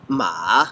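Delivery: "ma" dipping low and coming back up, third tone. Shown as a demonstration. Showing the Chinese tones, a low dipping third-tone ma. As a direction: let "ma" fall low, then curl up. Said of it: "makes a dip".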